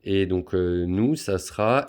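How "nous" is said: ordinary talk, nothing marked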